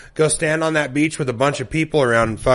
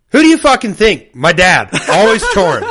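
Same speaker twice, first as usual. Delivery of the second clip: high, loud mock irritation; hammed-up grumpy reply, laughing at the end; irritated brother; 7 semitones higher, 6 dB louder than usual. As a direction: snap it back as the annoyed brother. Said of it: "he goes".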